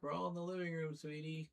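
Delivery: lightly cooed reassurance to the cat; reassuring the cat. Retold or imitated. Streamer retelling calling back to her cat, soft pet talk. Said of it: "So I let her know".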